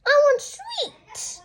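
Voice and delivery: high, slow whiny scream; screaming demand. Acted. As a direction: demand it like a spoiled child. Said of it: "screamed Henry".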